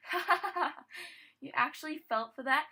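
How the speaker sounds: loud, high acted mocking laughter; laughing. A narrator's reading. Smallest filly mocking the fooled princesses, laughing. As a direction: laugh out loud through the taunt. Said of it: "couldn't help herself but laugh".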